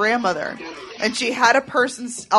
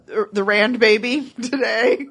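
usual speaking voice

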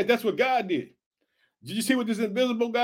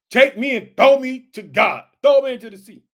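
acted bold plea; 3 semitones higher, 9 dB louder than usual. Preacher playing Jonah telling the sailors to throw him, loud resolve. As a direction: call it out with full resolve.

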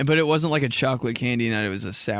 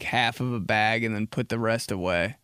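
usual speaking voice

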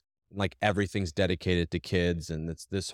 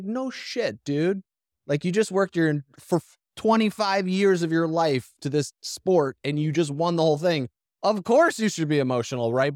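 higher, louder exasperated "come on, dude"; mock retort; 10 semitones higher, 5 dB louder than usual. Man scoffs at an emotional athlete, higher and louder. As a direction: scoff at the obvious, pitched up.

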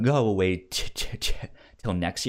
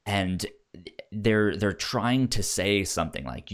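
normal talking voice

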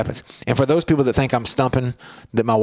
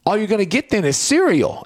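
higher, half-joking menace; hammed-up threat to a kid; mock threat; 8 semitones higher than usual. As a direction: threaten with breakfast, playfully. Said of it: "I said it like a threat".

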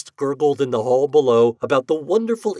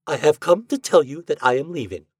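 higher, staccato announcement; 6 semitones higher, 4 dB louder than usual. Acted. Wife announces she is leaving her husband, staccato. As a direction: announce it word by detached word. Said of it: "she staccatoed".

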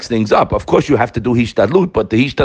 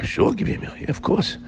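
whispered, much softer agreement; hushed reply; 8 semitones higher, 9 dB quieter than usual. Acted. Friend quietly agreeing to feed the servant, whispered.

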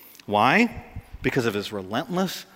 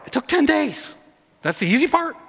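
astonished; 12 semitones higher than usual. Real, not acted. Stunned by cave rescue fact.

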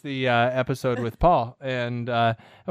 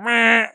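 high, loud nonsense bleat; loud "meh"; 9 semitones higher, 7 dB louder than usual. Shown as a demonstration. Host showing co-host the loud version, silly "meh". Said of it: "when you get loud".

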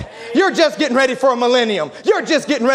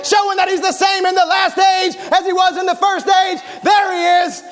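preaching at full force; 8 semitones higher than usual. Acted. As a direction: rouse the church, voice soaring and pounding.

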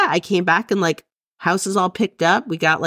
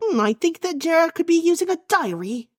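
put-on botched Australian accent that drifts British, pitched much higher; Nick's Australian accent, failed; 10 semitones higher than usual. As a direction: try an Australian accent and slide into posh British. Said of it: "now he's, like, a British".

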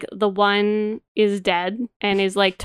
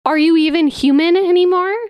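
mock outraged shout; 6 semitones higher, 5 dB louder than usual. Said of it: "And then Holden's like".

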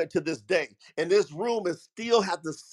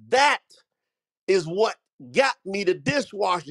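insistent retelling that leans on "That"; emphatic. Retold or imitated. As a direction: hit "That" hard, then settle. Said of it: "He kept saying it so emphatically".

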